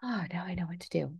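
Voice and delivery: hushed, private whisper; whispered realisation. Acted. Coach acting out finding the answer inside, whispered. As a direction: whisper it to yourself.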